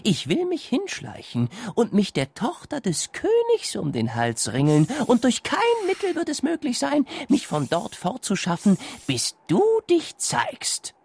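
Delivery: high, pleading serpent voice; pleading snake. A narrator's reading. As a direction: beg like a desperate snake. Snake speaks again.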